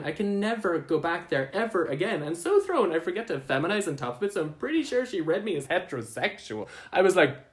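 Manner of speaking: ordinary talk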